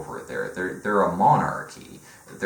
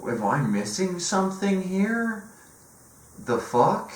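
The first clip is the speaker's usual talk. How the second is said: baffled disbelief; 11 semitones higher than usual. Retold or imitated. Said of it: "you're just like".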